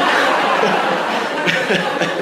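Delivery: a real burst of breathy laughter; loud breathy laugh. Real, not acted. Remembering his mom's fierce reply, a loud breathy laugh.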